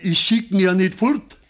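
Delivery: irritated shout. Acted. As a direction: fling the words back at her. Father defends himself, irritated.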